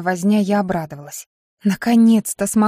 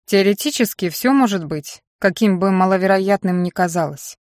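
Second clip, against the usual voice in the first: ordinary delivery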